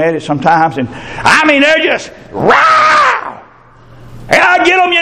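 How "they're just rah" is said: drawn-out high fighting roar; mock roar. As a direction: imitate the kids' brawling roar. Kids fighting, mimicked roar.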